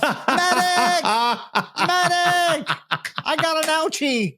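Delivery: man down shout. Pretend. Soldier calling medic, pinched finger.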